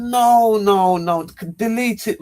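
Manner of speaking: high, loud, urgent objection; alarmed protest. Retold or imitated. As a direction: blurt out a string of high-pitched refusals. Retold demand to delete photo.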